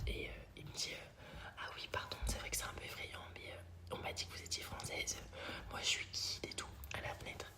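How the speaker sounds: hushed storytelling whisper; whispering the story so the neighbour won't hear. Real, not acted. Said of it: "j'ai peur de parler plus fort".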